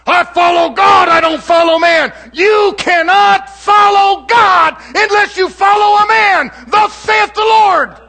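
high, loud, shouting sermon delivery; preaching at full force. Acted. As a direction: preach it out to the whole congregation.

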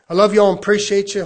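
loud, quick burst of affection; rousing preacher address. Real, not acted. Preacher thanking his congregation, loud and quick. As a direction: call it out fast to the room.

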